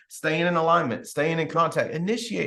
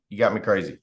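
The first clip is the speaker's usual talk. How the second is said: ordinary talk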